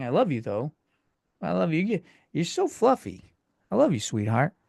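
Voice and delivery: talking sweetly to a pet that jumped up. Real, not acted. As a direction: coo fondly at the fluffy pet. Cooing to pet jumping on him.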